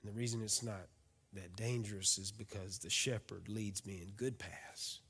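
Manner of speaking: soft, slow, near-whispered; hushed reflection. Acted. Pastor reading of still waters, hushed and slow. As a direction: draw them into stillness.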